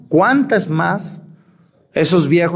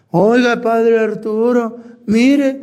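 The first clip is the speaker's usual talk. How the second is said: put-on higher, drawn-out pleading old man; imitated old man; 6 semitones higher, slower than usual. Old husband appealing to the priest, mock plaintive. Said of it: "me escribió un viejo".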